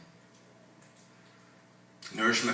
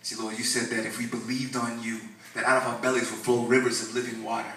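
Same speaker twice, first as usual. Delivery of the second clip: ordinary talk, nothing marked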